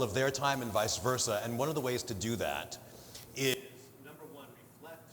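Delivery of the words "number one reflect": "number one, reflect" much softer, fading out; too quiet. Real, not acted. Dropping her voice before correcting herself to be heard. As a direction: let "number one, reflect" slip out far too softly. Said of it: "I have a big voice, I can just yell".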